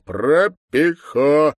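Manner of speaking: "Пропихо" very slow, high and loud, syllable by syllable; emphatic syllables. Acted. Deputy repeating "Пропихо" for the slow to understand, loudly syllable by syllable. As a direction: spell out "Пропихо" in stretched, forceful syllables.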